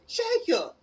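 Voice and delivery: high, loud sharp call of a name; the coach spotting the word on the test, scolding. Retold or imitated. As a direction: call him out, caught red-handed. Coach catches the rude answer.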